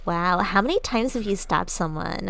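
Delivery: put-on gushing, ditzy Valley Girl; mock-impressed Valley Girl with lilting stress; Valley Girl accent. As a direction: fawn over a bad boy in Valley Girl speak. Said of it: "Valley Girl accent".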